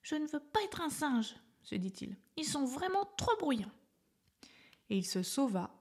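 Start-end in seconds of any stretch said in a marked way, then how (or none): 0.0-1.3 put-on higher young voice, mildly fed up; a small elephant pouting; little elephant voice. A narrator's reading. Little elephant fleeing the monkeys, high mildly fed-up voice. 2.4-3.7 put-on higher young voice, mildly fed up; a small elephant pouting; little elephant voice. A narrator's reading. Little elephant fleeing the monkeys, high mildly fed-up voice.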